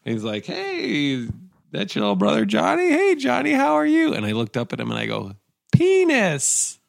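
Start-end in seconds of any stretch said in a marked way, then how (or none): 0.4-4.1 high, bright mock neighbour greeting; squeaky friendly old neighbour; cheery neighbour. Neighbour greeting little Johnny at the door, high cheery voice. 5.7-6.8 put-on very high tiny toddler word. Little Johnny blurting to the neighbour, high toddler voice.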